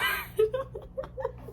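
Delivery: high, soft wheezy giggle; laugh. Real, not acted. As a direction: break into giggles mid-sentence. Breaks up mid-sentence.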